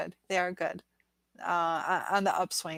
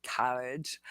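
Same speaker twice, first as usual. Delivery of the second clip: imitated Boston boyfriend's accent; 5 semitones lower, 5 dB quieter than usual. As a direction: lightly mimic a Bostonian. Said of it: "he says".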